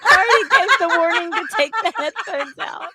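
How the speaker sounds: laughing. Retold or imitated. Laughing while quoting.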